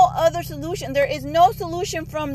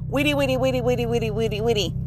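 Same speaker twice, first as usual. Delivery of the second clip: rapid droning run of nonsense babble; mock phone chatter; 3 semitones lower, 4 dB quieter, faster than usual. Woman mocking people always on the phone, monotonous babble. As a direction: chatter on and on without pauses.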